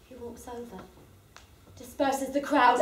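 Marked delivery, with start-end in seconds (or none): none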